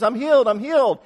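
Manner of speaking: high, loud cry of joy; leaping and shouting in joy; joyful scream. Pretend. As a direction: shout it out like she can't contain it. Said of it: "she screams".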